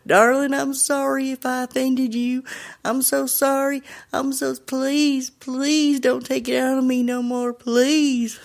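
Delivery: high, pleading Southern drawl; deep Southern accent. Retold or imitated. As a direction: imitate a Southern man begging a ghost for mercy. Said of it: "he has a really deep Southern accent".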